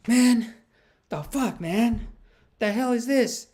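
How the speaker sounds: high-pitched retold exasperation; exasperated disbelief. Comic to his buddy as he came off stage, exasperated disbelief. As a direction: act out a baffled, fed-up comic.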